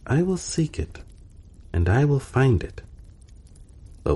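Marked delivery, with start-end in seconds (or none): 0.1-2.8 much higher, keen courtier promising to search; a hint of a flustered servant eager to please. A narrator's reading. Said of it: "said the gentleman in waiting".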